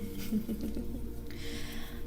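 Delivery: quiet, drawn-out giggle; soft chuckle. Real, not acted. Streamer after opening the tarot reading queue, soft chuckle. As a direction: let out a soft little laugh.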